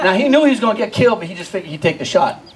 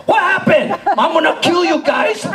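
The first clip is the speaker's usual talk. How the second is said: scolding aunt; 8 semitones higher, slower than usual. Retold or imitated.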